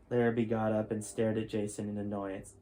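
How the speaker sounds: steady storytelling read-aloud voice; story narration. A narrator's reading. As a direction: tell the chapter like a bedtime story. Reads chapter twelve aloud.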